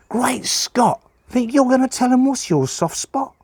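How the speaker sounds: high-pitched, hammed-up astonishment; teasing Pa. Pa making fun to his brakesman, mock astonished. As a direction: exclaim it in mock amazement, high. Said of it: "but just made fun".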